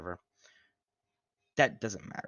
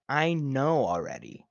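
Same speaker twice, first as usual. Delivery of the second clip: smug Amber; 3 semitones higher, 5 dB louder than usual. Retold or imitated. Amber's reply quoted.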